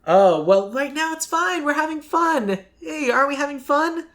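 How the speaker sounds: high-pitched mock-jolly villain toying with people; playfully menacing bad guy. Host pitching a villain who plays with morality, high mock-cheery voice. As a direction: play the bad guy pretending it's all fun. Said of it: "he is a legit bad guy".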